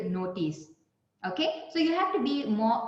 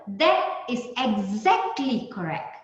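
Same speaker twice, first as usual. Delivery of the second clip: "exactly correct" pressed slowly, each word weighted; stress on exactly correct; slower than usual. Shown as a demonstration. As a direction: lean hard on "exactly correct". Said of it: "You're pressing the exactly correct".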